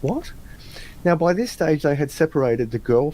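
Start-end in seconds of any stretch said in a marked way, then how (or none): 0.0-0.3 very high, soft baffled what. Retold or imitated. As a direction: react in disbelief. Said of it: "And I said".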